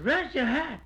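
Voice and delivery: a lightly voiced woman inviting him to rest; woman's voice. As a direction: lift the voice for the lady.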